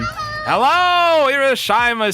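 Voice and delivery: high, loud mock-formal phone greeting; squeaky overdone formal phone hello; mock phone etiquette. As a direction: play up an over-polite Japanese phone greeting as a joke. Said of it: "did not know how to say hello".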